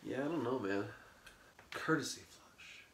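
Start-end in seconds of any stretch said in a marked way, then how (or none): 1.7-2.8 whispered band name, softer. Acted. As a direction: try the name out under your breath.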